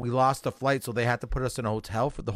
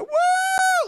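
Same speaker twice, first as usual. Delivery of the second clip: big high whoop, much louder; celebratory whoop; 5 dB louder than usual. Shown as a demonstration. Leaving the hooker's place and whooping in the street. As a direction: let out a triumphant woo. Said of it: "like this".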